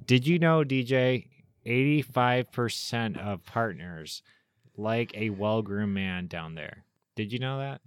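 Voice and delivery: salesy, emphatic pitchman voice; ad read. Pretend. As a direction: sell it like a cheesy commercial. Fake sponsor ad read.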